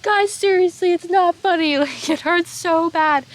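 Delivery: very high, loud mock wail; imitated tearful plea. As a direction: beg friends in hammed-up distress, dragging the end. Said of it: "I'm crying".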